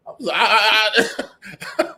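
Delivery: high, loud laughter breaking up the words; giggling through a stammer; laughing fit. Real, not acted. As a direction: crack up mid-sentence. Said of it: "Wrong accent".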